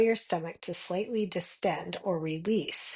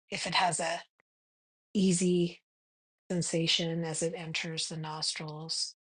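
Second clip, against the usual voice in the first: guided meditation. Acted. Meditation guide leading listeners to notice their breath, calm guided meditation. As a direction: guide them gently into the breath, unhurried.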